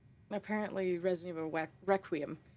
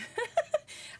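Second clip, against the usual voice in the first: very high squeal of laughter; shrill little laugh; squeaky laugh. Real, not acted. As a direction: burst into a high giggle. Laughs at herself.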